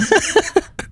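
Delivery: very high auto-tuned giggle; tiny chipmunk giggle; squeaky giggly alien. Real, not acted.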